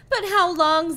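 loud high wail. Acted. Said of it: "Zach wailed".

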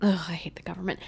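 whispered, low grumble. Real, not acted. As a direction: mutter it under your breath. Mock grumble aside.

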